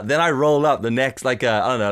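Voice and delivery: ordinary delivery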